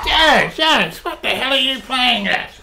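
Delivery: high, loud, angry yell at the driver; furious shout. Acted. Sir Ian yelling at his chauffeur for stopping, furious shout. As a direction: bark the name, outraged at the sudden stop. Car stops suddenly, passenger angry.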